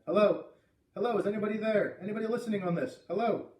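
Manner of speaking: loud, high-pitched calls into a microphone; calling out. Acted. Boy calling into a silent radio for anybody, calling out. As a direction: call out urgently, hoping someone answers.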